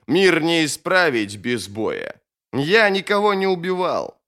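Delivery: proud wizard scoffing at his accuser; scornful mage. Acted. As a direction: throw the charge back with disdain.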